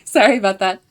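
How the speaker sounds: ordinary talk, nothing marked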